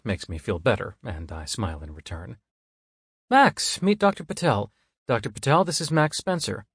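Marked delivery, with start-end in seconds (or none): 3.3-6.6 much higher bright introduction; higher female voice. Acted. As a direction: introduce them cheerfully.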